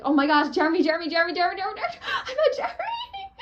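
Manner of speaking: high, loud shriek breaking into laughter; laughing, crying fangirl scream; ecstatic squeal. Retold or imitated. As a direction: scream with joy at meeting him. Ecstatic repeated chant.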